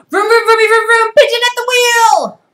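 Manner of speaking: very high, loud vroom-vroom play; excited pigeon. Acted. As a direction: squeal it out like a thrilled bird. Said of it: "He's so excited".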